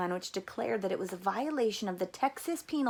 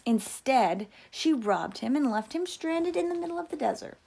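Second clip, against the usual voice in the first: ordinary delivery, nothing marked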